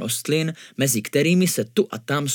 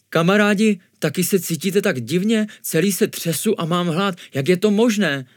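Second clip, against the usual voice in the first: higher, bewildered appeal to friends; puzzled, embarrassed; 6 semitones higher than usual. A narrator's reading. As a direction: ask in uneasy confusion. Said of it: "zeptal se rozpačitě Mnich". (rozpačitě, nejistě)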